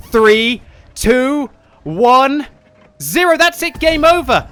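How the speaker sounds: high, loud countdown yell; shouted countdown. Real, not acted. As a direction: yell every number. Countdown to the loss.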